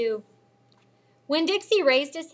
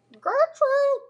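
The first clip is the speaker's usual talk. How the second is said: shrill high squawk, much louder; irritating parrot scream; 8 dB louder than usual. A narrator's reading. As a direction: screech the name like a parrot. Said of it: "screamed in a real irritating voice".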